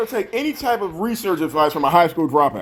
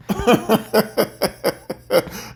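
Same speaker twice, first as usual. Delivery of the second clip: scornful laugh; 3 semitones higher than usual. Real, not acted. Laughing at her.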